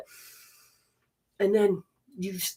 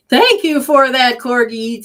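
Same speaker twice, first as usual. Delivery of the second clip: loud, slow, high, fond thank-you; delighted thanks; 5 semitones higher, 10 dB louder than usual. Real, not acted. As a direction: gush over the dog. Greeting the dog on camera.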